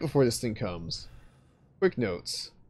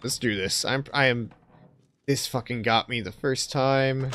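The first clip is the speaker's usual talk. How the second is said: ordinary talk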